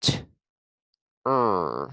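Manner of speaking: short "T", pause, drawn-out "er"; sounding out. Shown as a demonstration. Teacher finds two sounds in ter, sounding out. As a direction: split ter into "T" and a long "er". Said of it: "Ooh, only two sounds".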